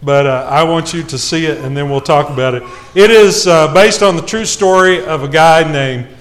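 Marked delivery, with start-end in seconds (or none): none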